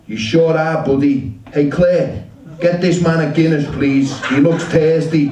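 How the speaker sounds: lightly acted loud, genial customer; hearty pub friend. American drinker ordering a Guinness for his new buddy, lightly acted heartiness.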